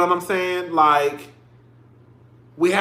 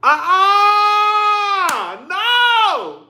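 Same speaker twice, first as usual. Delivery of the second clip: very high, drawn-out wail; crying out; 13 semitones higher, 3 dB louder, much slower than usual. Real, not acted. Streamer overcome by a tragic story, wailing in protest. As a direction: wail it out, refusing to cry. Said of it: "if I cry on this live".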